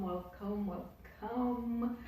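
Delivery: ordinary talk, nothing marked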